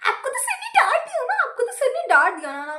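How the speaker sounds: high, gleeful teasing voice with a laugh, stretching ना; mocking classmate. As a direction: mimic a smug girl gloating over a scolding. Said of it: "she's like". (चिढ़ाती हुई ऊँची आवाज़ में सहपाठी की नकल)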